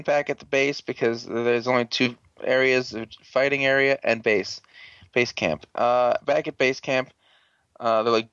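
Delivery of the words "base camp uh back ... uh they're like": ordinary talk, nothing marked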